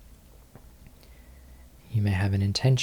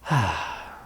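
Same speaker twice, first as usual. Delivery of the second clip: long, airy exhale; relaxed sigh out; audible sigh. Real, not acted. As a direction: let the breath go in a settling sigh. Settling body after stretching.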